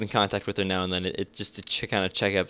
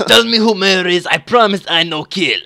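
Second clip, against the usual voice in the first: loud, slow mock Russian; bad Russian accent; 7 semitones higher, 8 dB louder, slower than usual. Russian player promising not to kill, bad loud Russian accent. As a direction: coax them loudly in a fake Russian accent. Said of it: "very bad attempt at a Russian accent".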